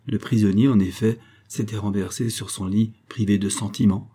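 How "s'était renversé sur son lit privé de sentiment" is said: plain reading voice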